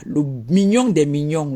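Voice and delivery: ordinary talk, nothing marked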